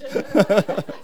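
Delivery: short real chuckle; amused laugh. Real, not acted. Preacher only now reaching the main topic, amused laugh. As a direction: laugh at your own long lead-in.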